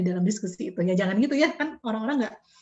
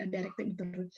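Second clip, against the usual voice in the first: whispered aside; 6 semitones lower, 11 dB quieter than usual. Real, not acted. Whispered aside while listing interview types.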